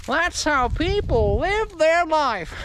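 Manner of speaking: high, slow drawn-out mockery; mock lament. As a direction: stretch it out high like a theatrical wail. Repeats the remark in mocking voice.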